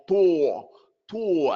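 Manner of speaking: a heavy "tor" from the back. Shown as a demonstration. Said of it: "You go".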